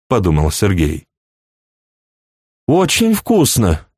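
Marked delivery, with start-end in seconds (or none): 2.7-3.9 put-on high, slow chirping girl; chirping bird person. A narrator's reading. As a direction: chirp it out sweetly like a little bird. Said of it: "прощебетала фрейлина".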